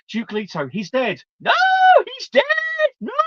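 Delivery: high, hammy mock grief; badly acted wail, pitched high; painfully bad acting. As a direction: imitate a painfully overacted death reaction. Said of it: "it was just painful. Absolutely painful. She goes".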